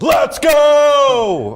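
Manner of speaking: hyped-up yell; excited shout. Acted. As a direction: shout it with huge energy. Hyped morning show energy.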